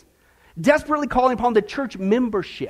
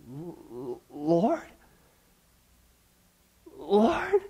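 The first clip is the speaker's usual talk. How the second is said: stammering dread; 5 semitones lower, 8 dB quieter, much slower than usual. Acted. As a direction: call out in quaking disbelief. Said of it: "put some emphasis behind these words".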